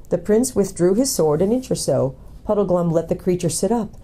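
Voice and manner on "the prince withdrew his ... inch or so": ordinary delivery, nothing marked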